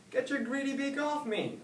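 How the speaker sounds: very high, loud frightened cry; wailing. A narrator's reading. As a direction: shriek at the bird in panic. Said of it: "Oscar wailed".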